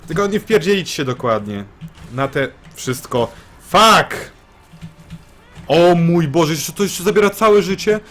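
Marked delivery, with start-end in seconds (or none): 3.7-4.3 loud, high frustrated swear; real rage at getting hit; angry curse. Real, not acted. Player takes a hit. 5.7-8.0 high, loud exasperated complaint; real exasperation, loud and high; exasperated outburst. Real, not acted. Losing all health, exasperated.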